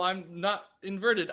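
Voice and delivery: ordinary delivery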